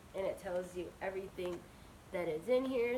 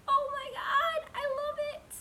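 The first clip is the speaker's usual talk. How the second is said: much higher, louder squeal of joy; excited squeal; 8 dB louder than usual. Real, not acted. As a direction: shriek with delight. Thrilled by purple unicorn pen.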